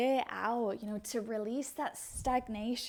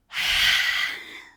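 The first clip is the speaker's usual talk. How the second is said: big loud sigh through the mouth; forceful sigh; 13 dB louder than usual. Shown as a demonstration. Teacher exhaling with the class, loud forceful sigh. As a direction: push the breath out hard. Final breath of the practice.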